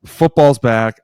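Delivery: loud, thrilled announcement; excited. Real, not acted. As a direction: burst out with real excitement. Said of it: "I am officially fucking excited".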